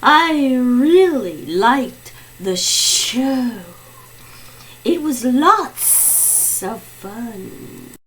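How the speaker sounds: slow, drawn-out guest voice; mystery guest. Acted. Mystery guest telling the host about the show, slow. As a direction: draw the words out unhurriedly.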